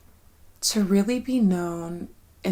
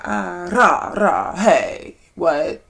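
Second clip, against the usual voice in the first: normal talking voice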